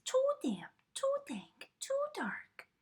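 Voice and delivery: tiny high squeak of a mouse voice; squeaky mouse. A narrator's reading. Mouse squeaking in the damp dark cave. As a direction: squeak it out like a nervous little mouse. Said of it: "Mouse squeaks".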